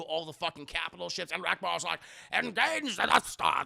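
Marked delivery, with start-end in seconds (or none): 2.3-3.6 high, loud order to attack; commander voice. Acted. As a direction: bark it like a fleet commander. Rebel commander giving orders.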